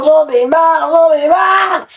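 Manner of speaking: loud, exasperated outburst; fed-up shout. Acted. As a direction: yell it like you've had enough. Shouted opening rant.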